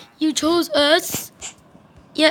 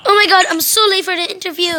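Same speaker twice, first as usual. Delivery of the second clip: panicked rush; 6 semitones higher, 4 dB louder, faster than usual. Acted. Panicking about being late.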